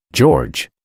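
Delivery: ordinary delivery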